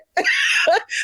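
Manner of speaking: bright burst of high laughter. Real, not acted. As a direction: laugh at your own comeback. Laughs at her own retort.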